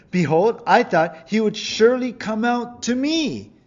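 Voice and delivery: the offended general protesting, voice up high and loud; angry general. Acted. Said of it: "Naaman was angry and went away saying".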